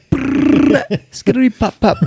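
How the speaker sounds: high mouth-made machine gun burst; gunfire imitation. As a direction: rattle off a fake gunfire noise. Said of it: "They're just like".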